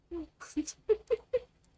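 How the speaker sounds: high staccato giggle; rising giggle. Real, not acted. Streamer amused at totally not eavesdropping, rising giggle. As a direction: let a giggle climb in short bursts.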